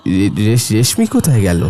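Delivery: low, stammered worry; uneasy bewilderment. Acted. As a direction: stumble over her name, low and puzzled. Wakes, finds his wife missing.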